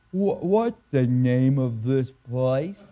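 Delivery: [name] drawing out his punchline slowly; a slow, stumbling setup to the punchline; slow halting question. Retold or imitated. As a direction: ask it slowly, stumbling on the first word.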